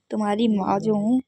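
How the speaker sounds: ordinary talk, nothing marked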